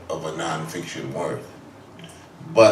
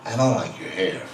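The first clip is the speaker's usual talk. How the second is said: Parker coolly insulting Miles, sinking lower and softer; 4 semitones lower, 3 dB quieter than usual. Retold or imitated. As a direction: drop lower and quieter with each insult.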